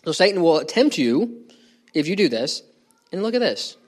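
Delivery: ordinary talk, nothing marked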